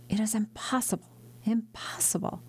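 fading into a stunned whisper; awed whisper. Acted. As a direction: breathe it out, unable to believe. Father overwhelmed, whispers disbelief.

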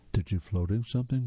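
ordinary delivery

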